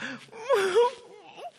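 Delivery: high wailing mock cry; father mimics daughter crying, high wail; imitated child sob. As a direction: squeal like a crying little girl.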